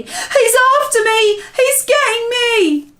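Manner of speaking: high, loud panicked wail; screamed. A narrator's reading. Giant chased in his nightmare, panicked scream. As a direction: shriek as if being caught. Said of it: "screamed the flesh lump eater".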